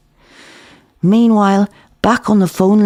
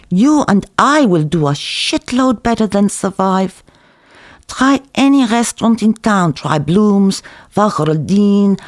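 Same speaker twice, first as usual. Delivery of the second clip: higher, lilting café owner selling hard; Zeev's sales pitch; 4 semitones higher than usual. Acted. Cook pitching his restaurant plan to the narrator, charming. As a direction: sell the deal with charm.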